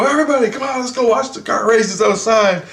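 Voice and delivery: high, excited rallying call; eager high call-out. Retold or imitated. Calling the class outside.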